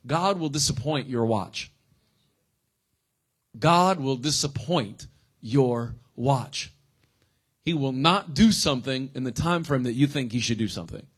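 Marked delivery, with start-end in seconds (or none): 3.6-6.7 slow, deliberate dictation pace; slow repeat for notes. Shown as a demonstration. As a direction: repeat it slowly so they can write it down. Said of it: "write this down if you're taking notes".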